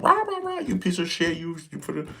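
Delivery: high, mocking bark of a drill instructor; hammed-up drill sergeant tirade; mock drill sergeant yell. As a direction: imitate a sergeant screaming in your face. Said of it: "yell at you and they're all in your ear".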